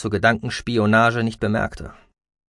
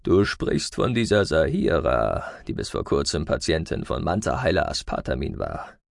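put-on lower, slowish old man's croak; 7 semitones lower, 4 dB quieter than usual. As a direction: keep the frail elder's rasp. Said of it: "krächzte der Alte".

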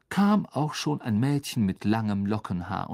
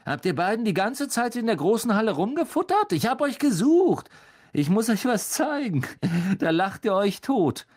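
put-on high, raised boy figure, bossy; Malfoy impatient; 9 semitones higher, 3 dB louder than usual. A narrator's reading. Malfoy tells off his cronies, high and lively. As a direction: scold them, then turn eager to share.

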